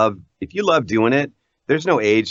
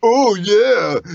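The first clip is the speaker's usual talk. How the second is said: loud, higher put-on voice; girls' stock impression; 11 semitones higher, 5 dB louder than usual. Girl mimicking her partner, exaggerated caricature. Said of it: "every girl's like".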